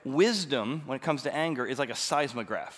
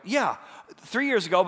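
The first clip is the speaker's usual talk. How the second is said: impatient echo, higher and louder; retold irritated customer retort; 7 semitones higher, 4 dB louder than usual. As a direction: throw it back, exasperated. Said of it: "I'm getting more and more irritated".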